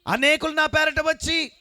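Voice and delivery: high, loud, slow scripture call; full-force preaching. Acted. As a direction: call the verse out over the congregation. Preacher leads scripture reading. (పెద్ద గొంతుతో వాక్యం చదవడం)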